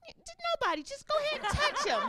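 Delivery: very high, quick stammered reply; dog owner voice. Acted. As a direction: rush it out high and flustered. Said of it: "She was like".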